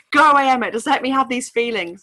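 hammed-up angry yell to go away; playful imitation of a furious wife fending off her husband; imitating Rosalie's outburst. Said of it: "And she's just like".

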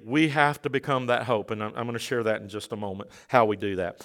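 soft, low preacher's confiding; quiet and low; hushed aside. Real, not acted. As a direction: drop right down and confide in the congregation.

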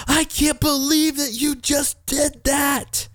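replaying the moment he snapped at his son; retold frustration. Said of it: "I was like".

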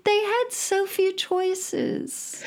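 high, slow mock pity. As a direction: sigh it with mock sympathy. Pitying old makeup choices.

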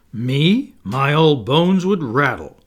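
loud mock indignation; pretended scandalized. A narrator's reading. Peter as father asked to dance, pretending to be scandalized. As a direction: protest grandly, putting on shock. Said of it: "he pretended to be scandalized".

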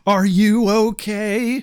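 slow, stunned hero delivery; lightly hammed-up awkward hero; George McFly impression. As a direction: draw each word out, dazed with your own bravery. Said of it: "He totally George McFly's out on her".

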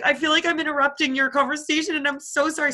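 imitating an upset friend, high-pitched; mock tearful apology. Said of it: "started crying because she just felt so bad".

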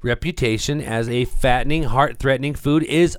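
hyped article read. Acted. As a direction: read it out like thrilling breaking news. Reads the article out theatrically.

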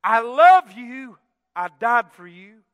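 loud, slow preacher's proclamation; emphatic declaration. Retold or imitated. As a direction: proclaim it, stretching the key word. Preacher voices God's words.

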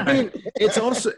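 usual speaking voice

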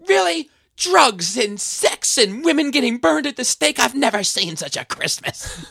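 put-on high, slow shocked old lady voice; scandalized old lady. Retelling The Ref, grandmother aghast at the dinner speech, high slow mock outrage. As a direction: clutch your pearls, aghast.

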